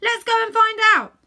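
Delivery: high, loud excited cry; eager call. A narrator's reading. Several animals urge each other on, loud and excited. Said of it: "said several voices".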